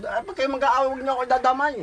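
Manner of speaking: lightly voiced neighbor scolding the couple, laughing at the end; mock scolding neighbor. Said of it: "duro-duro".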